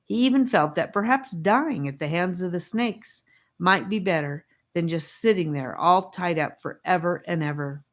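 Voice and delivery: ordinary delivery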